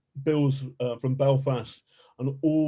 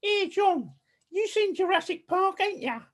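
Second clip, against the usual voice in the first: put-on very high-pitched Essex accent; Sarah's Essex accent. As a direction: mimic an Essex colleague way up high. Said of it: "do her accent for you".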